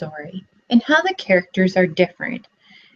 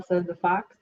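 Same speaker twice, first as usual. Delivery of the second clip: ordinary talk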